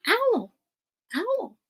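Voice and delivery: ordinary delivery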